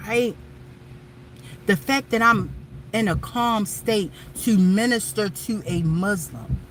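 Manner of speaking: ordinary talk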